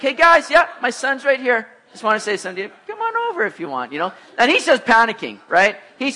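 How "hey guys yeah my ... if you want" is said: higher, teasing call across a parking lot; raised, friendly hail that eases off. Retold or imitated. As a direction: imitate a dad cheerfully hailing strangers. Dad calls to strangers, teasing son.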